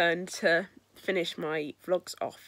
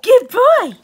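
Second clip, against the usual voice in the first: high and loud squeal of praise; squeaky praising a dog; 16 dB louder than usual. Real, not acted. Praising the dog after a trick.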